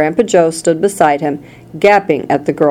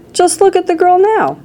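very high cry of alarm; distressed cry; 13 semitones higher than usual. A narrator's reading. Mother appalled by daughter's state, high distressed cry. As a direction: cry out high, volume held back. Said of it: "cried Missus Beauregard".

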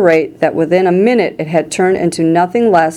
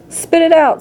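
urgent order; 11 semitones higher than usual. A narrator's reading. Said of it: "said Mister Wonka".

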